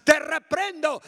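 high, loud te reprendo; loud rebuke. Retold or imitated. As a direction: shout te reprendo. Rebuke said twice.

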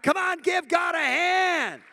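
high, loud, slow victory shout; rally the crowd at full voice. Real, not acted. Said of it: "give him a shout of victory".

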